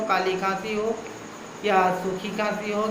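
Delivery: ordinary talk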